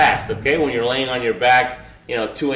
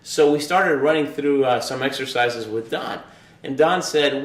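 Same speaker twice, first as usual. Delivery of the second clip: ordinary talk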